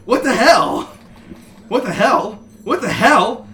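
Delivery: high, loud repeated yelps; yelping shock. Acted. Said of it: "who yelped and jumped away".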